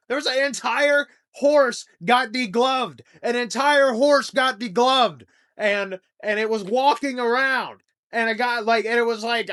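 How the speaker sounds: loud, high, aghast telling; horrified outburst. Real, not acted. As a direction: blurt it out, appalled and loud. Horrified rant about degloved horse.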